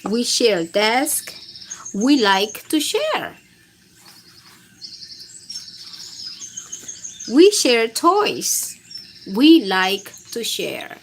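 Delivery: put-on slow, emphatic reading for little kids; simple picture book reading. A narrator's reading. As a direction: spell it out slowly for toddlers. Reads second book aloud.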